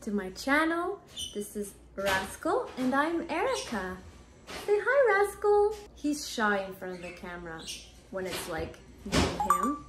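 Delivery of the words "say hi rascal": coaxing. Real, not acted. Coaxing the parrot to talk.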